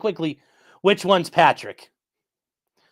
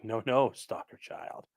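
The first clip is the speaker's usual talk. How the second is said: lower, menacing tough-guy voice, lightly done; imagined tough voice; 7 semitones lower, 9 dB quieter, slower than usual. Retold or imitated. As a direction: imitate the scary voice you'd expect. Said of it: "you expect him to have the voice of".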